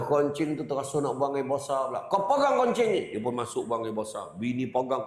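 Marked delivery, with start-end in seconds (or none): 2.1-3.0 higher, louder mock command; hammed-up husband ordering his wife; bossy order.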